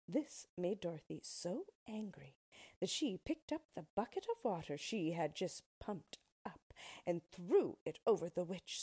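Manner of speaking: plain reading voice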